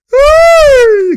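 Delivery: loud, sustained high shriek; high excited whoop. Real, not acted. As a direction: let out a long piercing cheer. Cheering a play.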